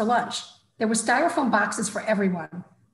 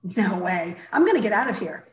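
plain reading voice

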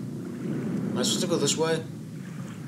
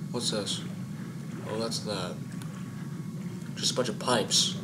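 hushed; 5 semitones lower, 9 dB quieter than usual. Real, not acted. Sneaking past a monster.

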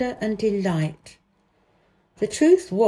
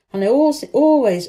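always, always pushed higher and louder; emphatic repeat; 5 semitones higher, 7 dB louder than usual. A narrator's reading. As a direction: lean hard on each always.

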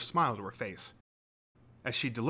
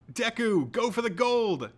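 high, loud, drawn-out cheer; shouted cheer; 11 semitones higher, 6 dB louder, slower than usual. A narrator's reading. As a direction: yell encouragement to the contestant. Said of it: "shouted Uraraka".

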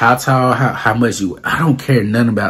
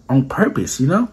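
ordinary talk

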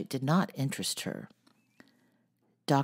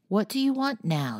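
put-on sharp, higher old lady's demand; 8 semitones higher than usual. A narrator's reading. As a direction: snap it out over the spectacles. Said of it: "sharply over her spectacles".

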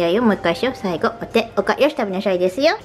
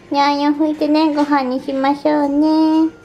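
pet-directed cooing; 7 semitones higher than usual. Real, not acted. Owner calling dogs to dinner, high cooing. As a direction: coo sweetly to a pet, pitched high.

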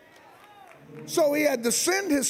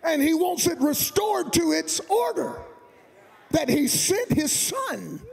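higher, shouted, stretched preacher build; preaching climax; 6 semitones higher than usual. Real, not acted. Pastor driving home God loves all of it, shouting. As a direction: build the congregation to a peak.

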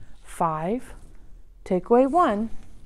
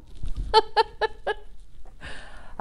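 breathy high giggle; a quick airy laugh; light laugh; 10 semitones higher, 6 dB quieter than usual. Real, not acted. Laugh after chant.

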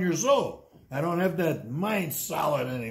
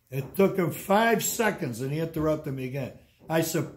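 normal talking voice

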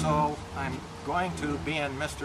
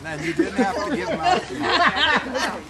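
higher, laughing through the words; 11 semitones higher, 6 dB louder than usual. Real, not acted. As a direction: laugh while echoing the joke. Echoes the joke right after the dunk.

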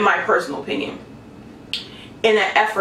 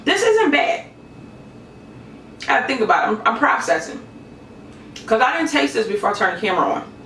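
talking while chewing food; eating from a fork; mouth full, chewing; 4 semitones higher, faster than usual. Real, not acted.

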